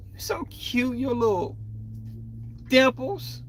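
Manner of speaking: high cooing voice, like fussing over an infant; high baby-talk coo. Pretend. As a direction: coo at him like he's a cute baby. Mocking cooing at Aries baby.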